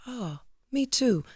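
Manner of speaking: soft, high, slow innocent reply; imitated confused mother. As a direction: lightly voice a mother's innocent surprise. Voices her mother with Alzheimer's.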